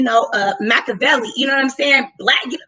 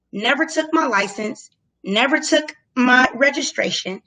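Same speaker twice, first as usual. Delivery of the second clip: ordinary talk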